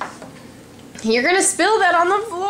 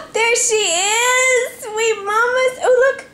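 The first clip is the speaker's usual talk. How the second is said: excited reveal; 5 semitones higher than usual. Real, not acted. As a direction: peekaboo delight. Peekaboo reveal.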